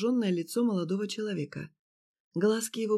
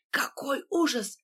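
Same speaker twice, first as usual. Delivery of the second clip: slower, higher anguished cry; 6 semitones higher, slower than usual. A narrator's reading. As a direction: lose composure, cry it out. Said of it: "потеряв самообладание".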